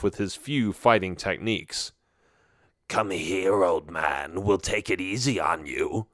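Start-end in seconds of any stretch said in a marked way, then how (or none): 2.9-6.1 low, soft menacing orc voice; low creepy taunt to an old man. A narrator's reading. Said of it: "a creepy orc says".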